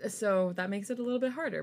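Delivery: ordinary talk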